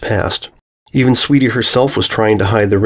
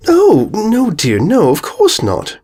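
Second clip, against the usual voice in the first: cooing; 11 semitones higher, slower than usual. A narrator's reading. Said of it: "Rarity cooed".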